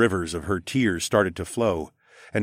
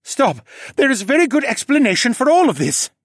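high, loud falcon-man outburst; urgent interruption; 7 dB louder than usual. A narrator's reading. Falcon man interrupting to explain himself, urgent and high. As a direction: cut in urgently, voice pitched way up. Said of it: "Horace interrupted".

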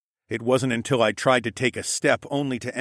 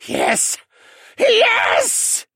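hissed triumph; 7 dB louder than usual. A narrator's reading. Villain sees the tears fall, hissing in triumph. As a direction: hiss it, then let it burst out. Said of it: "Vane hissed".

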